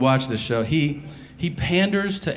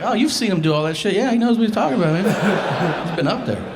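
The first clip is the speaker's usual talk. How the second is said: higher, laughing hype voice; mock host; 6 semitones higher than usual. NASA staff defending Captain Kirk, higher laughing hype. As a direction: vouch for him like a fanboy, laughing. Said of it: "And they're like".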